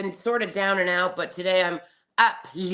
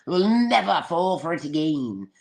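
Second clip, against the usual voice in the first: usual speaking voice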